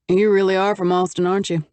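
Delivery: dry and wry, a touch amused; a lightly voiced man, wry; wryly. A narrator's reading. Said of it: "he asked wryly".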